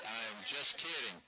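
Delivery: ordinary delivery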